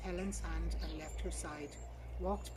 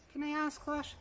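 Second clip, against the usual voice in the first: higher Southern English receptionist voice; polite Southern English receptionist asking; Southern English accent; 9 semitones higher, 5 dB louder than usual. A narrator's reading. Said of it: "A Southern English accent".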